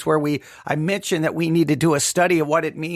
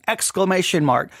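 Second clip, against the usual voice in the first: normal talking voice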